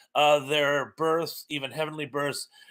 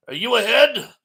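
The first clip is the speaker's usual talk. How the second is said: loud, high demand; cop's shout; 7 semitones higher, 8 dB louder than usual. Retold or imitated. Cop yelling at the driver in the joke. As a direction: bark the question at him. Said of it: "what is the old joke from".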